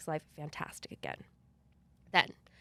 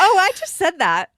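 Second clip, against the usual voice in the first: much higher and louder, mock-scandalised; shocked laughing outburst; 11 semitones higher, 15 dB louder than usual. Real, not acted. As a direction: gasp it out, appalled and amused. Shocked at her own story.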